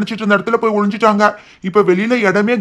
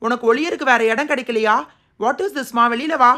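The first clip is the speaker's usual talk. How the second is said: a scolding schoolmaster; stern teacher; 3 semitones higher, 3 dB quieter than usual. Acted.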